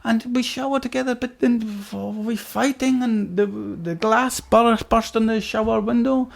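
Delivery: Swedish cellmate on the verge of tears; mock Swedish accent, near tears. Cellmate recalls the fight in tearful mock Swedish accent. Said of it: "almost, like, in tears".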